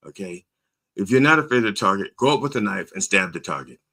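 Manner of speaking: usual speaking voice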